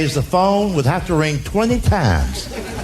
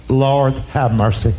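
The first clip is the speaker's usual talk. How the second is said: a hint of a weary mother; 8 semitones lower than usual. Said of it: "she would go".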